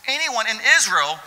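Jesus marvelling at the centurion's faith; Jesus proclaiming. Acted. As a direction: declare it like Jesus astonished. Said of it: "and he says".

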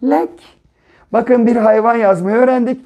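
usual speaking voice

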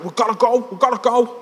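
put-on high, hurried-sounding Scouse accent; urgent Scouse. Lad pulling his mate away, high urgent Scouse. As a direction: urge him away in Scouse. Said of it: "give you a glimpse of the scouse".

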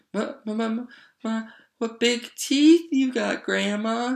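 put-on very high frightened child, slowing down; stammer, then squeal it out shakily; stuttering, shaky. A narrator's reading.

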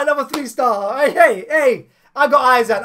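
ordinary talk

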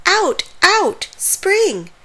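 put-on storybook toddler's cry, pitched high; gleeful shout. A narrator's reading. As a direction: shout it like an excited baby, way up high. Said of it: "shouted to her brother".

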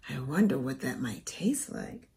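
soft whispered wondering; whispered aside. Real, not acted. Aside to children.

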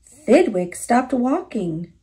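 plain reading voice

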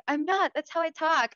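imitated defensive insistence; passionate mother imitated. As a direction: protest like a passionate mom defending herself. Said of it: "she's very passionate when she talks".

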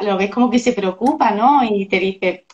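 usual speaking voice

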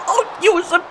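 high-pitched jeer after laughing; high crowing taunt; gleeful taunt. Real, not acted.

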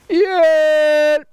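high, loud cry for help; little mouse shrieks for help; storybook scream. A narrator's reading. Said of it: "skreg lille mus".

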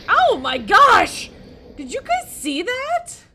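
very high, very loud startled shriek; real startled outburst; shocked scream. Real, not acted. Lightning strikes in the game.

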